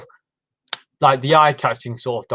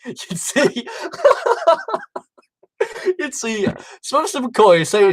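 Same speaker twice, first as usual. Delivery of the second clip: laughing; 10 semitones higher, slower than usual. Real, not acted. Recalling funny outtake.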